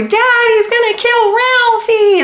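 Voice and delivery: put-on high, slow whiny kid voice; Christmas Story kid quote. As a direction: wail it like the scared little brother in the movie. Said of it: "like the dad in fucking A Christmas Story".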